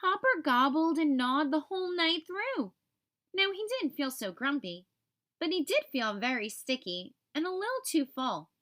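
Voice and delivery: ordinary delivery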